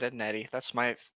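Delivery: ordinary talk, nothing marked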